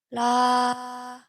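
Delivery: level mid note on la; medium pitch la. Shown as a demonstration. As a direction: back down to the middle note. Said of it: "locating the medium pitch".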